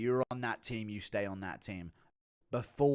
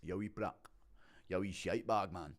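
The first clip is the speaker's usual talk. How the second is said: softer, restrained Scottish insult; Scottish accent; 5 semitones lower, 5 dB quieter than usual. Shown as a demonstration. Showing a viewer Scottish insults, restrained Scottish accent. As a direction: trade Scottish insults without raising the voice. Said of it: "a Scottish accent's a bit more like that".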